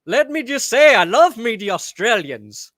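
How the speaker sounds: loud, high mock Australian; bad Australian accent. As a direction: attempt an Australian accent, badly. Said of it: "I can't do impressions".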